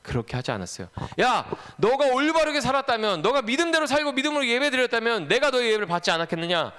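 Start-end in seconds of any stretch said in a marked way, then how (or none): 1.2-6.7 high, forceful acted rebuke; insistent, raised-pitch reproach; God's warning. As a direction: call Cain out as a reproving God. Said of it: "하나님께서 경고의 말씀을 주셨어요".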